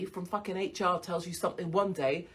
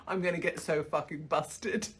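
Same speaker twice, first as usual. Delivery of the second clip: normal talking voice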